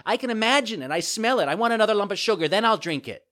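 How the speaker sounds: put-on high, loud bratty child; stubborn puppet boy, whining. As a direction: argue loudly in a child's whine. Puppet figure in story.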